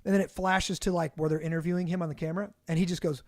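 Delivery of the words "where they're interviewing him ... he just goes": ordinary talk, nothing marked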